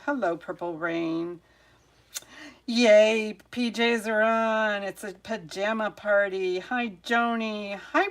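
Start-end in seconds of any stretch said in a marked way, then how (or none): 2.7-4.8 loud, higher cheer; excited greeting. Real, not acted. Streamer cheers viewers in pajamas, excited. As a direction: cheer as the pajama crowd arrives.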